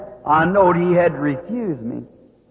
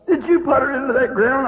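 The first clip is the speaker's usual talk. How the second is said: put-on little boy asking; 7 semitones higher than usual. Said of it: "he said".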